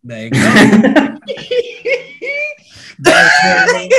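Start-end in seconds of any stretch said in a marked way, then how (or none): 0.4-2.5 big delighted laugh; loud high laugh. Real, not acted. 3.0-3.9 loud high hype shout; excited cheer at the drop; hyped cheer. Real, not acted. Hyping the drop.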